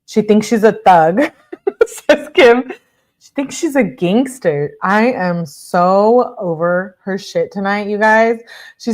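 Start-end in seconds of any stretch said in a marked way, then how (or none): 0.1-4.7 laughing. Real, not acted. Laughing at friend's texts.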